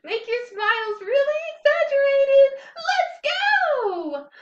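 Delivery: giddy, high, loud call to start; excited shout. Shown as a demonstration. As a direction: whoop it up like a cheerleader. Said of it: "Let's do it happily".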